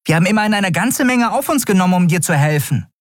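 high, rushed protest; indignant reproach. Acted. Fairy confronting the man they helped, indignant. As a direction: reproach them in a high, quick voice. Nelen confronts Kai over their efforts.

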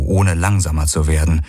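usual speaking voice

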